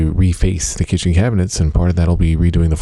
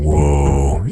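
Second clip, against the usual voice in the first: long mock "whoa" of shock; shocked audience; 2 semitones lower than usual. Actor retelling audience reaction to his scene, mildly shocked. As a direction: imitate a crowd's stunned reaction, understated. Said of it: "in shock".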